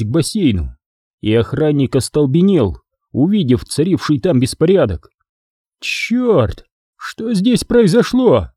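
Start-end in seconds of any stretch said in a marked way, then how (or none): 5.8-8.5 much higher shocked outcry. Acted. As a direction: gasp it out in shock, high. Guard sees bloody pool.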